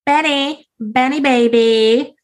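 high, sing-song flirty southern lady; flirty Belinda. Acted. Belinda sweet-talking the farmer, high and coy. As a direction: coo his name up high like a flirt.

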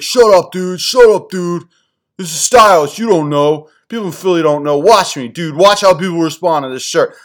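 high, loud defensive bro voice; mock outraged New Yorker brushing them off; New York friend. New Yorker friend defending pink shirt to Philly guys, high and loud. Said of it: "And he's like".

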